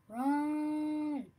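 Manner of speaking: long, high, loud holler; urgent shout. Real, not acted. Player hollering to get moving in the game, urgent shout. Urgent call during the match.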